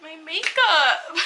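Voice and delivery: high, slow, tearful catch; real tears breaking through; crying now. Real, not acted. Worrying about her makeup while crying for real. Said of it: "bawling his eyes out like I am now".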